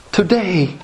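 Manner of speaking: mock feel-good preacher, slightly put-on; a hint of smiley motivational preaching; Joel Osteen. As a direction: lightly imitate a continuously smiling preacher. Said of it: "Joel Osteen type of preaching".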